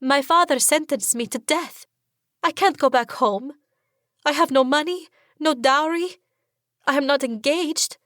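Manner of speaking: high, pressed words close to tears; rising fear. A narrator's reading. Condemned girl tells her rescuer her fears, high and frightened. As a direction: let panic climb as she lists her losses. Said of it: "fear filling my chest".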